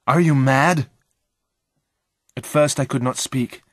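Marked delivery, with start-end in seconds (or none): 0.1-0.9 loud, raised-pitch challenge; appalled accusation. A narrator's reading. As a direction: demand an answer in shock. Friend accuses after horrific discovery.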